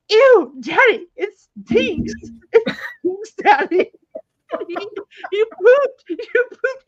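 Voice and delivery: put-on high, loud toddler shrieks broken by laughter; imitating screaming child. Mom mimics daughter screaming at dad among passengers. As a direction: shriek accusations like a disgusted little girl. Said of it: "she's, like, screaming it".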